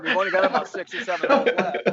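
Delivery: laughing while delivering the punchline; quick, giggling tease. Retold or imitated. Teasing his hero Arnold, laughing through the line.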